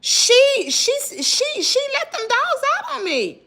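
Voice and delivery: high, stammering accusation; insistent accusation. Retold or imitated. Accusing the neighbor to the police officer, high and insistent. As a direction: make the officer believe you. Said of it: "I'm like".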